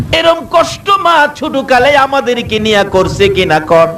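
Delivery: high, sung-out waz chant building to a shout; preaching at full force. Acted. Preacher to the congregation, rising sung waz chant. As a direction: lift the sermon into a wailing chant.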